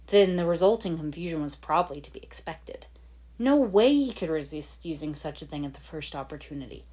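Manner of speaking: ordinary delivery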